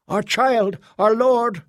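high, rising cry of awe; ecstatic cry. Acted. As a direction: cry out in rapture. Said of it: "cried another".

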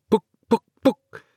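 sharp high snapping sounds; snapping noises. Acted. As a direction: snap each word out high like cracking laces. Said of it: "he heard dry snapping noises".

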